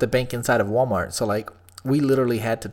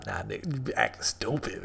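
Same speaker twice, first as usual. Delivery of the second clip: normal talking voice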